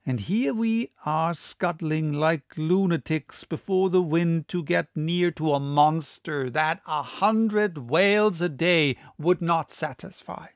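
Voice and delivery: ordinary delivery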